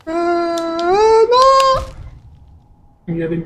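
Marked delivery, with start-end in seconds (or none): 0.1-1.8 a long high wailing no; real dismay, high and drawn out; dismayed cry. Real, not acted. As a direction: cry out as you fall. Missed a platform, falls.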